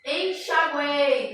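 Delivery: "Enxaguei" drawn out slowly to show u-e-i; teacher slowly sounding a word; slow syllable demo. Shown as a demonstration.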